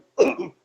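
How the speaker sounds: nervous little throat clear. Shown as a demonstration. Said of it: "clearing my throat before every call".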